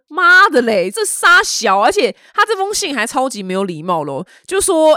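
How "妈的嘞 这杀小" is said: loud, high burst of indignant swearing; outraged curse. Retold or imitated. Pub owner reacting to the rude letter, loud and outraged. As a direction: blurt it out like a furious pub owner. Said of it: "这个酒吧老板想说".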